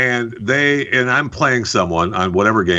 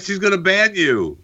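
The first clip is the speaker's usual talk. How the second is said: normal talking voice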